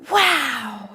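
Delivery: long, drawn-out wow of wonder; awed exclamation, gliding down; ecstatic wow. A narrator's reading. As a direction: let it spill out in awe. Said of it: "spills from my tongue ... with its ecstasy".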